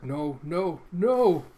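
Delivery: building from loud to a full scream; rising panicked cries. Acted. Half-wolf fighting the armor, panicked rising scream.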